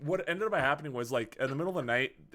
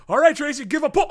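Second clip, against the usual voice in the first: urgent nurse; 11 semitones higher, 10 dB louder than usual. Retold or imitated. Nurse urging the wife to push.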